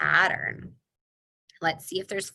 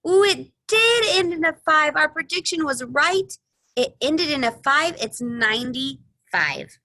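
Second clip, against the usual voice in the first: excited; 10 semitones higher, 8 dB louder than usual. Acted. Prediction proved right.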